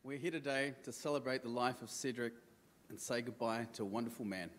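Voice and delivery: soft, halting words of farewell; faltering tender eulogy. Real, not acted. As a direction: speak gently, pausing between phrases. Opening of father's eulogy.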